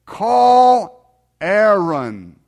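very slow, loud, drawn-out words; exaggerated voice command. Preacher to a car system that mishears him, loud and extremely drawn out. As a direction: stretch each word hugely for a machine.

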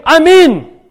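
fervent, loud "I'm in" cry; eager volunteer. Pretend. Imagined church member answers the pastor, fervently eager. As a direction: shout your yes like the first to sign up. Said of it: "You will be the first one to say".

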